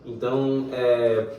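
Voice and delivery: ordinary delivery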